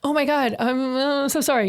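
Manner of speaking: high, halting mock apology; acted-out embarrassed sorry; retold flustered apology. Acts out her apology at the doors.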